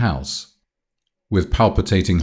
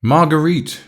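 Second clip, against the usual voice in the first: high, raised call of her name; repeated call; 10 semitones higher, 4 dB louder than usual. A narrator's reading. Paul calls his sister back from the door, calling out. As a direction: call out to get her attention. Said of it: "reiterated Paul".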